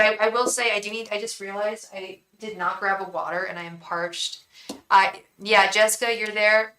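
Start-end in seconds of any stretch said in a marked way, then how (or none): none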